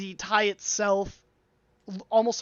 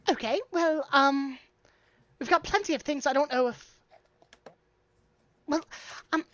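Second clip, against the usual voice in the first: high, halting, uncertain voice; baffled dwarf shopkeeper; 6 semitones higher, faster than usual. Acted. Dwarf shopkeeper puzzled by a boastful customer's request, baffled. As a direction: flounder politely in a high voice.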